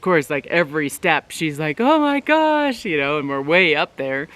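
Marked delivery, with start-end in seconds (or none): 1.8-2.8 high, slow frightened "Oh my gosh"; like a scared kid climbing a tall slide; scared gasp. Retold or imitated. Daughter scared on each step.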